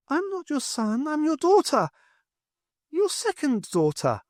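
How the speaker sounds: put-on a dead girl speaking through the boy; eerie girl's voice. A narrator's reading.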